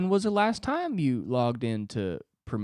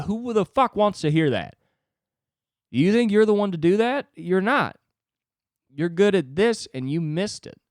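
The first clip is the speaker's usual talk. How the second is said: louder, higher stern coach; coach scolding; 7 semitones higher, 5 dB louder than usual. Pretend. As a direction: snap at him like a tough coach. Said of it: "He's like".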